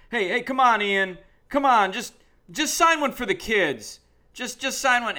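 loud, high, pleading yell at the player; retold yell. Autograph hound yelling at a player to sign for the kids, loud and high. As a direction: call out over the crowd to make him sign. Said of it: "he yelled that".

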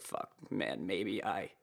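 under-the-breath curse; muttered worry. Retold or imitated. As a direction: mutter it to yourself in mild panic. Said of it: "so I was like".

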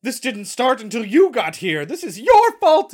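hammed-up hysterical accusation; accusing mother. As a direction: blame her, voice rising to a shriek. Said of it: "mother who's like".

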